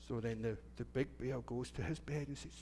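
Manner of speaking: normal talking voice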